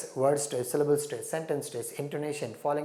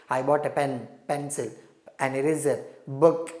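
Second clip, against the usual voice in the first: "pen", "pencil", "eraser", "book" each rising, with "book" said louder; enumeration rising tone; 3 dB louder than usual. Shown as a demonstration. Teacher modelling a listed sentence for students, rising tone on each item. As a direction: list the items with a rise on every word. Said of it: "So on every word, you find rising tone".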